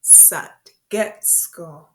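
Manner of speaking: "Satgetsko" very slowly in separate syllables; slow repeat-after-me. Shown as a demonstration. Teacher inviting learners to say it along, slow and segmented. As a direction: leave gaps inside "Satgetsko" for learners to follow. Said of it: "So you can say it with me".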